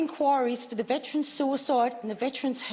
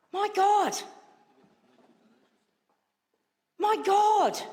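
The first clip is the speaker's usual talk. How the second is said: outraged; 2 semitones higher than usual. Real, not acted. Senator outraged at minister.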